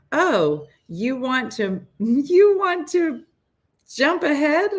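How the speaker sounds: high teasing voice, drawn out with a laugh; mocking app voice. Duolingo mocking her for skipping ahead, in a high teasing voice. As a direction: sneer like a smug app daring her to skip ahead. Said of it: "It said".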